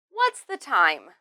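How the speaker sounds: "What's" and "time" emphasized, ending in a fall; falling finish on "What's the time". Shown as a demonstration. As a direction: stress "What's" and "time", drop the pitch at the end. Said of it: "emphasize what's and time, and then end it".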